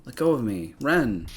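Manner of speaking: struggling, loud plea; pulled away from Ren, crying out; desperate cry. Acted. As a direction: fight the grip while calling out. Heroine struggles against guards.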